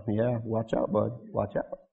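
a soft menacing aside; quiet warning. Real, not acted. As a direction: mutter it under your breath.

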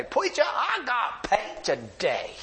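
high, slow imitated older man; odd high character voice, drawn out; Herbie. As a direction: announce it slowly and proudly in a put-on voice. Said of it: "And Herbie".

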